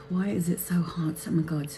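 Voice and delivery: hushed, near-whispered grumble to self; muttered aside. Real, not acted. Host searching through live comments, muttering to self. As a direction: mutter it very low, under your breath.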